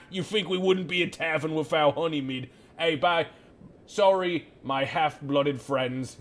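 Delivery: half-orc barkeep scoffing at an elf question; mocking barkeep. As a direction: scoff at the customer, then laugh it off.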